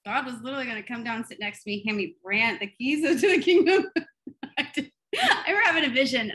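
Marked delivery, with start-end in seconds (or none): 2.6-4.9 laughs through the words, high and loud; laughing. Real, not acted. Laughing at her naive expectation. 5.2-6.3 laughing. Real, not acted. Laughing recalling her expectations.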